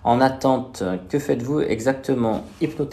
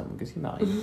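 ordinary delivery

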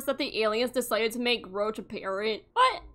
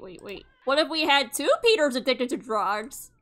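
usual speaking voice

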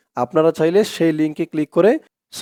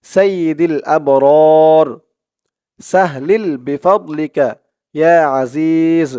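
recite along; much slower than usual. Shown as a demonstration. Teacher leading viewers to recite along, slow devout chant. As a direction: chant it slowly so viewers can follow. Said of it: "আমার সাথে বলবেন".

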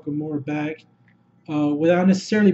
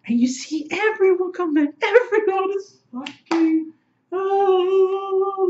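high, squealing fan awe; overwhelmed excitement; 12 semitones higher, slower than usual. Real, not acted. Fan reliving everyone coming back, high ecstatic gushing. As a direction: pitch soars and stretches into a long oh.

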